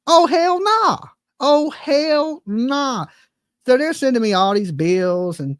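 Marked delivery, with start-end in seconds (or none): 0.1-1.0 higher, punchy hell nah; outraged dismissal; emphatic refusal. Real, not acted. Refusing the water bill. 1.4-3.1 very slow, stretched hell nah; slow, emphatic dismissal; drawn-out refusal. Real, not acted. Repeated slowly.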